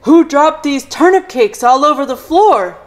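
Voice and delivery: loud indignant dad voice; father bellowing in surprise; Mister Chan exclaiming. Acted. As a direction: boom it out, outraged. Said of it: "exclaimed Mister Chan".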